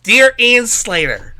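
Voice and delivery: hammed-up outraged complaint letter; imitated complainers. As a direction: declaim it like an offended letter writer. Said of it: "many people are like".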